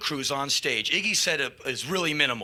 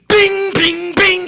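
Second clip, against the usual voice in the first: loud high "bing" sound effects; imitated bouncing noise; 13 semitones higher, 11 dB louder, much slower than usual. Iggy bouncing about the stage, punched sound effects. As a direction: punch out each bing like a ricochet.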